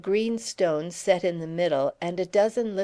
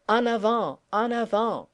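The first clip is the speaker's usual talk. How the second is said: higher French battle cry; 4 semitones higher than usual. A narrator's reading. De Lapp crying out a French charge, wild and elated. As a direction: urge the troops forward. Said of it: "as he had done when he was off his head".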